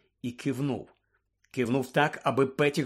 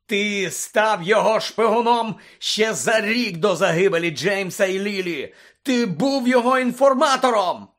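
high, raging shout; furious yelling; 8 semitones higher, 7 dB louder than usual. Acted. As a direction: hurl the accusation at full voice. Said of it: "заволав Блек".

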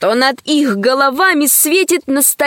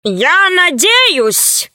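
very slow, higher character voice; drawn-out doctor voice; 6 semitones higher, much slower than usual. A narrator's reading. Doctor addresses the visitors with hope, drawn-out character voice. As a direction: stretch it out in a put-on, higher voice.

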